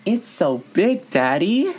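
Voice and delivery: put-on high, slow little-girl coo. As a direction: coo it like a small child. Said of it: "little girl voice".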